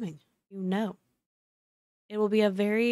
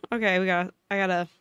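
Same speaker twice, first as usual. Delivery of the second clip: ordinary talk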